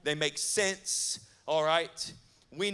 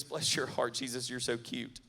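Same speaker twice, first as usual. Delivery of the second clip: mock Southern sweetness; 5 semitones lower, 6 dB quieter than usual. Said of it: "Southern hospitable way of saying".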